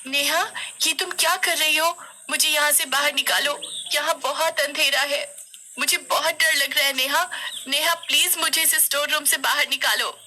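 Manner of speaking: put-on high, urgent child's plea; frightened pleading. A narrator's reading. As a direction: beg to be let out of the dark. Kosi locked in dark room.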